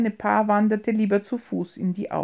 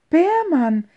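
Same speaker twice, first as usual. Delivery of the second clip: a high, loud cry of his name; heartfelt outcry; 8 semitones higher, 6 dB louder than usual. A narrator's reading. Emilchen crying out to the man she loves, high and loud. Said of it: "mit einem Aufschrei des Herzens".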